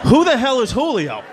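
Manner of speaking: high, exasperated question; baffled outburst. Acted. Son answering dad's new accusation, high exasperated bafflement. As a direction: demand it high, totally lost.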